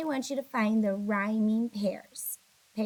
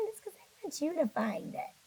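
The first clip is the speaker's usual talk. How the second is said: ordinary talk, nothing marked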